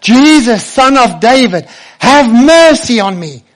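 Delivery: high, loud desperate cry; full-voiced shout for mercy. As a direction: yell it over the crowd, slowly. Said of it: "He shouts even louder".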